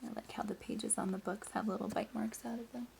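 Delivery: soft whisper to the class; whispered aside. Real, not acted. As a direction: lean in and whisper it.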